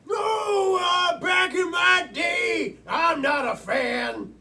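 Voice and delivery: put-on high, loud, very slow old man; cranky grandpa dragging out every word. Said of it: "the players are gonna pretend that they're".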